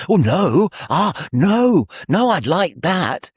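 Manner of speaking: swooping high, loud mock protest; playfully eager guest. Young guest reacting to news of the piercings, playfully eager. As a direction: lift each "no" high and let it swoop.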